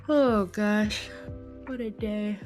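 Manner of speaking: ordinary talk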